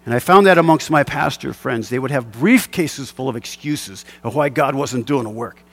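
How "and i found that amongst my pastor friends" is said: ordinary delivery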